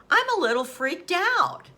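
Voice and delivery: mildly freaked out. Retold or imitated. Patient admitting unease to her nurse, mildly freaked out. Patient retold as unsettled by visions.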